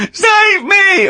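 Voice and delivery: very high, comic squeal for help; mock screaming. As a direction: squeal it like a hammed-up fire victim. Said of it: "They'd be screaming".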